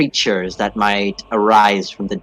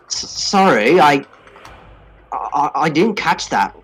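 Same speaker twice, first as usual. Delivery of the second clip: put-on slipping Australian accent, a touch higher; a shaky attempt at an Australian accent; 6 semitones higher than usual. Boxer asking the party to repeat, in shaky Australian. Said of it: "Why did your accent change?".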